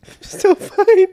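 tearful and choked; crying. Real, not acted. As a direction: cry while insisting you're fine. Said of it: "Why are you crying?".

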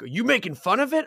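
high-pitched retold accusation; accusing question. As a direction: challenge him, pitch jumping up. Viewer accuses him in retold row.

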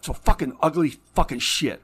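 real anger boiling over; furious outburst. Real, not acted. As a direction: spit out the disgust at full volume. Ranting about glorified violence.

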